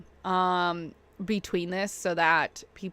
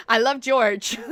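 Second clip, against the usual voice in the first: laughing; 2 semitones higher, 5 dB louder, slower than usual. Real, not acted. Joking about Walmart brand.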